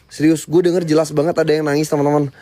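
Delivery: ordinary talk, nothing marked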